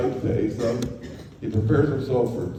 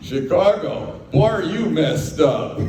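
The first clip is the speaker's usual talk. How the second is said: put-on trucker's incredulous voice, higher and louder, slowing on "Boy, are you messed up"; mocking trucker; 6 semitones higher, 6 dB louder, slower than usual. Trucker Roy laughing at the lost young driver, incredulous mock voice. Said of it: "He looks at me and goes".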